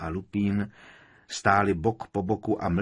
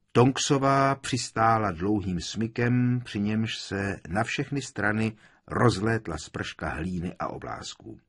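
plain reading voice

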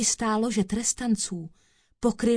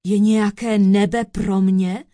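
loud, incredulous question; forceful outburst; crying out; 8 dB louder than usual. A narrator's reading. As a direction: cry it out in disbelief. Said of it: "vykřikl podvodník".

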